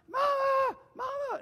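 high, loud panicked cry for mama; hammed-up kid screaming on the run; fleeing little boy. Boy takes off running from the dog, screaming for mama. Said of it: "he takes off running".

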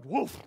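loud, goofy mock woof; imitated harmless dog bark; toothless bark. Preacher jokes the toothless devil can only bark, goofy woof. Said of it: "Every time he barks, all he does is go".

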